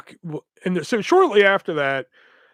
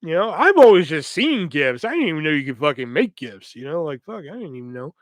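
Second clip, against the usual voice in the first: usual speaking voice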